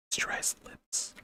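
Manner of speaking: breathy whisper. Real, not acted. Unvoiced aside.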